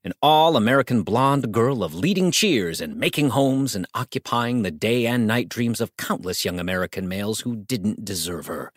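ordinary delivery

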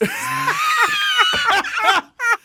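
high shrieking laughter; wild laughing fit. Real, not acted. Bursts out laughing.

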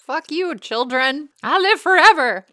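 high, rising to a loud cry; mock gloating. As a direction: crow it in mock triumph. Mock gloating about being immortal.

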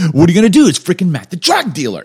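high, loud retold exclamation; alarmed protest. Freshmen excusing themselves over the drug dealer, alarmed.

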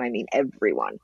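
everyone said low and heavy, falling at the end; stress on everyone. Real, not acted. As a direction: lean down hard on everyone. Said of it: "when I say everyone".

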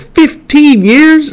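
high, drawn-out, disbelieving squeal; incredulous echo. Retold or imitated. Jennifer repeats Bruce's answer back to him, incredulous. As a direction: stretch FIFTEEN YEARS out way up high, mock shock.

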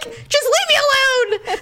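high-pitched mock wail; whiny brush-off. Hurt wife waving husband away, whiny. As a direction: plead to be left alone, high and hammed-up.

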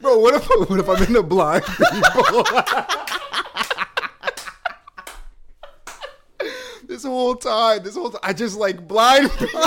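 words squeezed out through laughter; cracking up mid-sentence; laughing hard. Real, not acted. Laughing at own realization.

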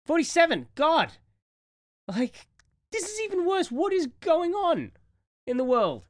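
high, loud dismay. Real, not acted. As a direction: cry out in disbelief. Upset at celebrity deaths.

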